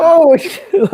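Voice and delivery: high, loud shocked yelp through a laugh. Retold or imitated. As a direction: burst out laughing in disbelief. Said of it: "He's like".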